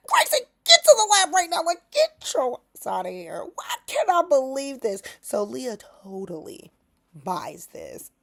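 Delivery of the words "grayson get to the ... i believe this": high, fast urgent command sliding into a whisper; frantic boss voice dropping to a hiss; urgent order. Retold or imitated. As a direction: hurry him off in a mock panic. Retelling Leah's urgent order.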